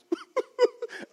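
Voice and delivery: imitated high-pitched crying whimper; ugly cry. Preacher showing how he broke down in the living room, mock ugly cry. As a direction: mimic a squeaky ugly cry. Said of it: "ugly cry".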